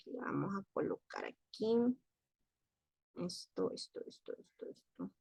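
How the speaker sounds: very soft absent-minded mumble; talking to herself. Real, not acted. Teacher fiddling with the screen, murmuring to herself. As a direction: murmur it under your breath while busy.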